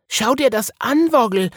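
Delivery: high, loud excited call; Wiggle calling. A narrator's reading. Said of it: "rief die Häsin".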